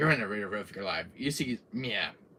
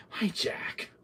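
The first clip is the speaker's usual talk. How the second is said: whispered; 9 semitones higher than usual. Real, not acted. Exasperated whisper.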